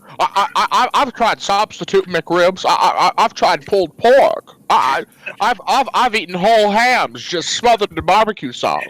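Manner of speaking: stammering, desperate addict in character; Jimmy Stewart. Acted. Jimmy confessing a McRib addiction, stammering desperately. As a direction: stutter through a hammed-up confession. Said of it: "I'm Jimmy Stewart and I'm jonesing".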